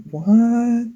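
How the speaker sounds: surprised what. Pretend. Repeated confused what takes.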